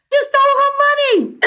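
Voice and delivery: very high-pitched retold outburst; blurted accusation. Telling how she couldn't help confronting Anna, high-pitched outburst. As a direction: throw out the accusation in a high squawk.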